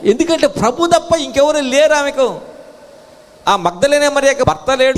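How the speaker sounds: high, insistent explanation; fervent preaching. Real, not acted. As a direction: drive the point home from the pulpit. Preaches emphatically.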